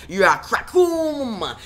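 high, slow shouted crackoom; shouted crash sound. Pretend. Sound effect, Joker slams Wonder Woman.

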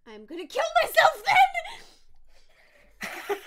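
high, loud, slow laughing wail; mock dramatic wail. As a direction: ham up the melodrama, laughing. Mock dramatic threat read aloud.

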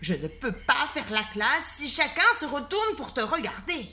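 a flustered schoolteacher losing patience; exasperated teacher. Acted. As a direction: shout the class down in a put-on voice. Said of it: "cria monsieur Normand".

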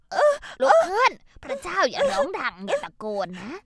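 shouting at her not to shout; exasperated shout. Acted. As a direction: yell at her to hush. Mother-in-law scolding, fearing neighbours.

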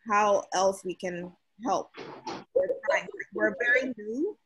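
ordinary talk

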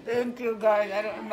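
soft, choked-up thanks with a laugh; crying, worn out. Real, not acted. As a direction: thank them through tears. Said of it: "I can't stop crying".

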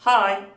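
"Hi" loud with a full "ah-ee" glide; bright-voweled "Hi" ending in "ee"; "ay" diphthong. Shown as a demonstration. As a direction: punch out "Hi", gliding from "a" to "i". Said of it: "ay sesini oluşturuyor".

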